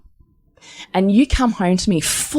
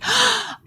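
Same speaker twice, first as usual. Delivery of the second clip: sharp high gasp of delight; excited gasp. Retold or imitated. Wife gasping to husband over her angel number. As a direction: gasp like you just saw your lucky number.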